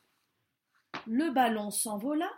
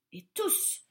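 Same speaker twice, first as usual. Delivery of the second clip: plain reading voice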